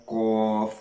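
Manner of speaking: قاف from the back of the tongue, heavy; heavy qaf shown; qaf demo. Shown as a demonstration. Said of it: "اسی طریقے سے ادا کرنا ہے".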